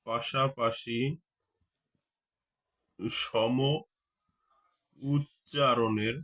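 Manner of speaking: উচ্চারণের spelled out very slowly; slow dictating pace; slow dictation. Shown as a demonstration. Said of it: "teacher dictating slowly".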